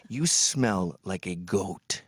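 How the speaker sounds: slow, low, savouring each word; slow deliberate insult. Retold or imitated. Passenger insulting the smelly cab driver, slow and low. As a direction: land the insult slowly. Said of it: "I said".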